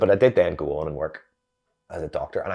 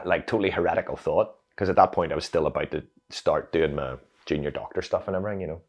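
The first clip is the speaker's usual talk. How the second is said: ordinary talk, nothing marked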